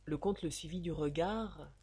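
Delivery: ordinary delivery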